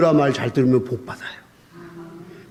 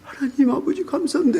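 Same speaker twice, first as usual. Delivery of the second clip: fervent thanks; 9 semitones higher than usual. Retold or imitated. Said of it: "했더니".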